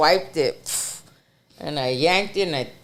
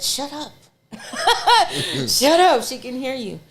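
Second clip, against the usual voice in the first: laughing shut up; 9 semitones higher, 4 dB louder than usual. Retold or imitated. Hushing boyfriend on the phone in the closet, laughing high. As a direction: giggle while hushing him.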